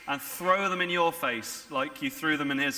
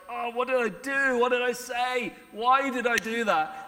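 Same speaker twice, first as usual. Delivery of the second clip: anguished regret; 7 semitones higher, 3 dB louder than usual. Retold or imitated. Shimei voiced in regret.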